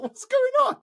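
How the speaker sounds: very high squeak of disbelief; astonished. Retold or imitated. Passenger stunned by a celebrity aboard, squeaky astonishment. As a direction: shoot right up in pitch, stunned.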